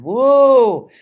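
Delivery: loud, high, drawn-out whoa; surprised whoa. Real, not acted. Streamer reacting to the weather in Manhattan, loud surprised exclamation.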